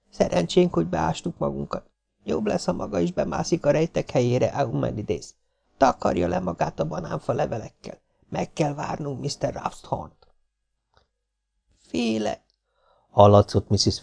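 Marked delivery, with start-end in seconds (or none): 0.1-10.3 high, soft, secretive instructions; hushed young woman in hiding. A narrator's reading. Hiding girl gives instructions. 11.8-12.4 tiny high voice out of the dark; thin, frightened voice. A narrator's reading. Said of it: "Farmington vékony hangja a sötétből".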